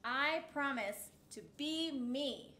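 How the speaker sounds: ordinary delivery, nothing marked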